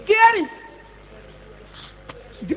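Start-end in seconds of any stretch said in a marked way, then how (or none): 0.1-0.5 mocking echo. Real, not acted. Mocking the word caring.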